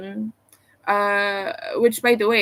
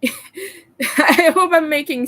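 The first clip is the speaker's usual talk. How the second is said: slow, high and loud through a laugh; giggling self-consciously while speaking; 6 semitones higher, 6 dB louder, slower than usual. Real, not acted. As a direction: laugh it out, a bit embarrassed. Laughs at own troll comparison.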